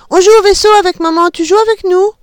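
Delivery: put-on high, quick, eager little girl. A narrator's reading. Little girl inviting her father into the game, eager. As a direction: ask daddy to join excitedly. Girl voiced asking dad.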